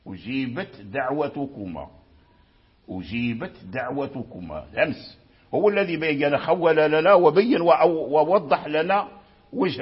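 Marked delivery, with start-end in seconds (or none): 0.1-4.7 أجيبت with the ت breathed out voiceless before دعوتكما; whispered clear ta. Shown as a demonstration. Teacher showing the voiceless ta in أجيبت دعوتكما. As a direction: let the ت of أجيبت be voiceless and separate. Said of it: "همس التاء".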